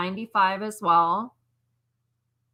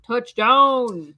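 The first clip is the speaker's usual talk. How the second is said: loud, higher celebratory shout; touchdown cheer; 4 semitones higher, 12 dB louder than usual. Real, not acted. Seeing the Packers scored, excited shout. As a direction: call out the score like a fan.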